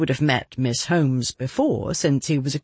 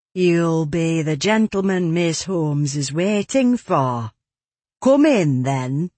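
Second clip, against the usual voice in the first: put-on a housekeeper's broad Yorkshire accent. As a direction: voice her in thick Yorkshire. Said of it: "said in a broad Yorkshire accent".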